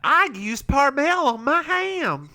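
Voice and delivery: high, hammed-up Southern twang; mock ad testimonial in broad Southern; Southern accent. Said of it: "with a Southern accent like that".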